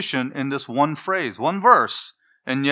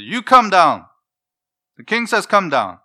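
loud, higher, mocking "You come down"; sarcastic retort; 6 semitones higher, 8 dB louder than usual. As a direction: throw the captain's words back at him. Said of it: "notice the sarcastic play on words".

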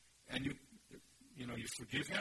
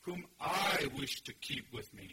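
"I" drawn out slowly and punched louder; emphasis on I; 2 semitones higher, 5 dB louder, slower than usual. Shown as a demonstration. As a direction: lean long and hard on "I". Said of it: "Here is emphasis".

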